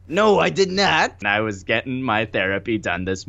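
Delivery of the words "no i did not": put-on high, slow old lady voice. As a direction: answer as a prim old aunt. Aunt Tom answers in character.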